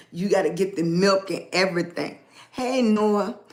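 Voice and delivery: usual speaking voice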